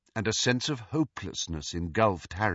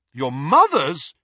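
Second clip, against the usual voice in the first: loud, slow, amazed question; astonished; 5 semitones higher, 9 dB louder, slower than usual. A narrator's reading. Harry learns of the ghost's mother, astonished. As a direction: echo it back in amazement.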